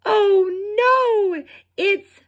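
high, alarmed cry; worried cry. Acted. Fifi fears her flute will fall, high and alarmed. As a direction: panic as the flute nears the falls. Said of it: "Fifi cried".

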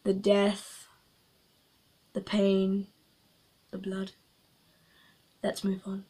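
soft, dark, fading words; mock ominous hush. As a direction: drop into a sinister murmur. Dark dramatic list.

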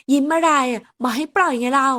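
loud nagging to be let go; annoyed demand. Acted. Secretary loudly demands her boss let go. She struggles, demands release.

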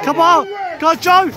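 loud touchline cheer. Real, not acted. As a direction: yell encouragement at the player. Cheering on the pitch.

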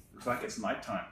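ordinary delivery, nothing marked

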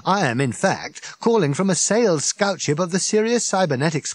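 a wound-up alien rambling on; Ford, tipsy and indignant; Ford, excited. Acted. Ford retells phone call.

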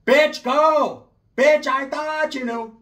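high, loud put-on aunt yelling go; a shrill tia shooing the girl out; bossy aunt. Aunt ordering Sheila out of the house, loud high voice. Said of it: "my tia's like".

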